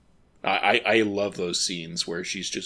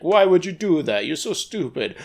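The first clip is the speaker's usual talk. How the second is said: high-pitched, loud family scolding; mock insulting; 8 semitones higher, 8 dB louder than usual. Parent in the movie scolding their child, mock insulting. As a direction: berate them in exaggerated exasperation. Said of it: "from one moment insulting".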